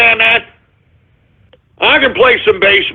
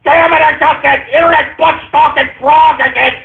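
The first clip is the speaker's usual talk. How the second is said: exasperated shout; 5 semitones higher than usual. Acted. Host fed up with a repeat caller, shouting. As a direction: howl it like he's been had again.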